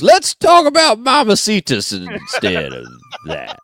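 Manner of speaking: change the subject with a grin; Google South voice. Pretend. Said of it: "and it goes".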